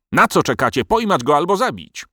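high-pitched, impatient royal fury; furious order. A narrator's reading. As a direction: goad the soldiers on angrily. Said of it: "wychodząc z siebie z wściekłości".

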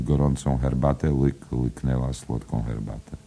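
usual speaking voice

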